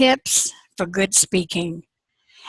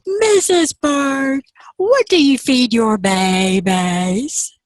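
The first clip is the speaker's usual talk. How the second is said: lightly voiced frog; 4 semitones higher, 4 dB louder, slower than usual. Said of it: "and she says".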